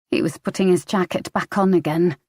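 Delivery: plain reading voice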